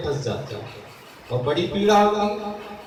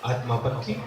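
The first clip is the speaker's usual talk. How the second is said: soft answer; 9 semitones lower, 6 dB quieter than usual. Acted. Repeated key phrase, dropped low.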